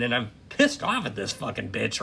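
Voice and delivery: ordinary talk, nothing marked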